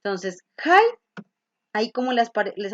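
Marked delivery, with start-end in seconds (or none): 0.0-1.2 hide said slowly as English /ajd/. Shown as a demonstration. Teacher modelling the verb hide. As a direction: stretch out hide for the class. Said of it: "El verbo hide".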